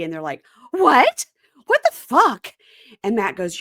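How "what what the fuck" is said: high, loud "what?" then an incredulous curse; a mock-shocked, hammed-up double take; stunned disbelief. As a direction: play the friends' outraged shock. Friends stunned by the revelation.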